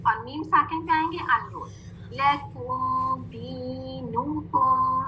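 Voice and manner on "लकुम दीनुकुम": लकुम दीनुकुम very slow, long rounded vowels; drawn-out recitation. Shown as a demonstration. Teacher leading pupils through two words, slow recitation. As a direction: chant लकुम दीनुकुम on a level tone. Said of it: "सब मिलके ये दो लफ्ज पढ़े".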